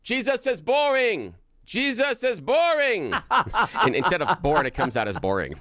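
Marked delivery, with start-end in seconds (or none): 0.0-3.1 put-on high, slow, loud child's proclamation; little angel yelling. Kid angel announcing to the pageant audience, high loud yell. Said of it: "and yells". 3.2-3.8 high laughing burst; burst of laughter. Real, not acted. Retelling the pageant joke to cohost, high laughter.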